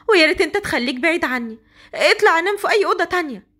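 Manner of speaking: put-on frightened young bride. Hasnaa pushing her drunk husband away, high and frightened. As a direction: push him off, scared and protesting.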